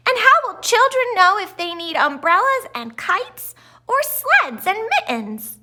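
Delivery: plain reading voice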